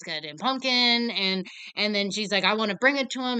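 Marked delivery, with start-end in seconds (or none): 2.4-3.4 pleading mother voice, a bit louder; mock begging. Mother begging the employee on the phone, mock pleading. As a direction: beg the employee like a desperate mother. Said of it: "she's, like, begging".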